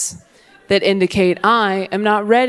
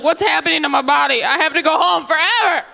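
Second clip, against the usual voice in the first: put-on panicked boy; 5 semitones higher than usual.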